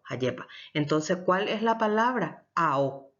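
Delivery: usual speaking voice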